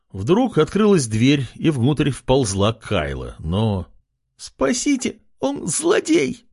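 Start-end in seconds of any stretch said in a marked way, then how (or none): 4.4-6.5 very high, slow pleading squeal; мольба. Acted. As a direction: beg shrilly for rescue, dragging it out. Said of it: "молила она". (пронзительная мольба о спасении)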